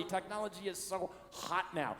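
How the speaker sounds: ordinary talk, nothing marked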